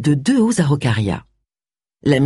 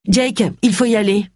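higher-pitched, brisk prompt to leave; brisk call; 6 semitones higher than usual. A narrator's reading. Tony suddenly tells Jake they must go, brisk. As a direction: call out to get him moving. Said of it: "lança-t-elle en se levant vivement".

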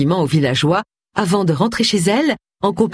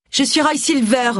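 high-pitched public announcement; high, showy character voice; announcing; 9 semitones higher than usual. A narrator's reading. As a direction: announce yourself to the cameras. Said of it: "annonça-t-il".

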